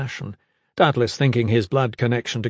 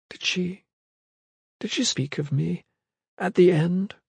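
fragile, higher voice with hesitant pauses; tearful hesitation; 6 semitones higher than usual. A narrator's reading. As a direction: ask timidly, close to tears. Tearful woman asks about dead friend.

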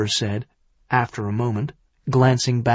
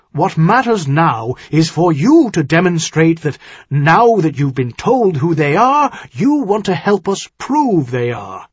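loud, raised, insistent argument; sharp rebuke; 6 semitones higher, 6 dB louder than usual. Acted. Earl tells son-in-law he has dealt with traitors, sharp. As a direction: drive the point home sharply, voice up. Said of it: "his voice sharper than it had been".

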